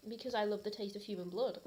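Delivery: normal talking voice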